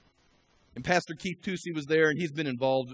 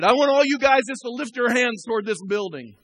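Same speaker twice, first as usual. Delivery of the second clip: raised, high, commanding call; exhorting general; 10 semitones higher, 11 dB louder than usual. Retold or imitated. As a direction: call out to your men like a general. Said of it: "reminded me of a general just exhorting us".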